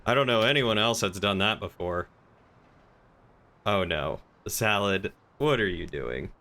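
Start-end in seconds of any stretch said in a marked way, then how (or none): none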